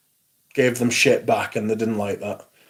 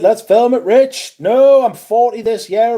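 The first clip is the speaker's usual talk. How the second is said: excited; 9 semitones higher, 5 dB louder than usual. Real, not acted. Mock excitement at the video idea.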